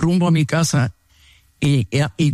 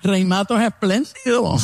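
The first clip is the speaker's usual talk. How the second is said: high, sarcastic squeal of fake amazement; mocking surprise; 3 semitones higher than usual. Enemy mocking the preacher's offer of a fancy restaurant, high sarcastic squeal. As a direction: jump up high and mock his generosity. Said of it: "Y él".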